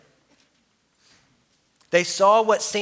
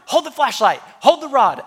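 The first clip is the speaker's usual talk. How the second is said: urgent orders; 8 semitones higher, 5 dB louder than usual. Retold or imitated. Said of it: "And I'm like".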